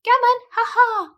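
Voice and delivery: high squawking ha ha; magpie doctor. Acted. Magpie doctor cackling at Ruby, high squawk. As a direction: chatter it like a magpie.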